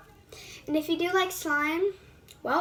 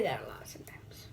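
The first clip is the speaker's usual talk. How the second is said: sheepish unvoiced whisper; whispered confession; 11 dB quieter than usual. Real, not acted. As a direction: breathe it as an aside.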